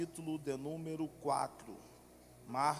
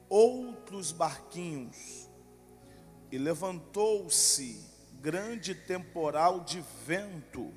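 slow, louder reading of the Gospel; solemn scripture reading; 2 semitones higher, 4 dB louder, slower than usual. Acted. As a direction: read the verses with weight. Reads Mark 4 aloud. (leitura solene da Bíblia)

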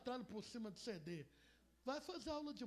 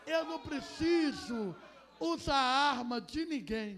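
slow, loud, emphatic "eu não preciso"; loud slow declaration; 11 dB louder, slower than usual. Acted. David telling Saul he needs no weapon, loud and slow. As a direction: proclaim it slowly like a vow.

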